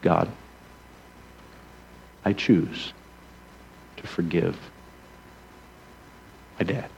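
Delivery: soft, low, broken prayer of surrender; hushed teary plea, barely holding together; tearful prayer. Retold or imitated. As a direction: pray it quietly through held-back tears. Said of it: "I started crying".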